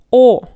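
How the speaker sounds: O as a loud, round stand-alone vowel; letter O. Shown as a demonstration. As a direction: sound O out loudly on its own. Teacher names the letter loudly.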